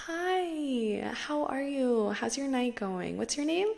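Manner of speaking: high, bubbly and friendly greeting; sweet charming friend. Retold or imitated. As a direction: charm the desk clerk with a sunny high voice. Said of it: "try my best to reenact the way".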